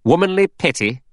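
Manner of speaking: high, mocking questions with a touch of a sneer. A narrator's reading. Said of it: "Lombard sneered".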